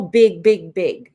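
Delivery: loud, punched repeats; big delivery. Shown as a demonstration. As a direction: demonstrate going big on every word. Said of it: "go from somewhere lower than loud".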